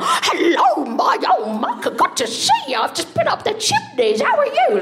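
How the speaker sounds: very high, screechy mock Cockney; cartoon Cockney chimney sweep; put-on Cockney. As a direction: greet them in a wildly overdone Cockney squeal. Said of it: "thinking he's gonna be like".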